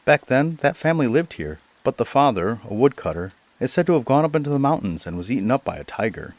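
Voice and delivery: mild exclamation. Acted. Gentleman to the ragged questioner from the mountains, mildly exclaiming. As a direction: exclaim without a full shout. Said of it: "and then he shouted".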